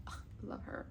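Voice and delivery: soft unvoiced sigh of love; whispered swoon. Real, not acted. Swooning over the death card in a whisper. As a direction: whisper it like a crush.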